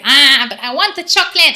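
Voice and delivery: put-on loud, high twangy childish whine; bratty twang demo. Shown as a demonstration. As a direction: whine it like a kid wanting chocolate. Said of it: "you hear already this having a little bit twang".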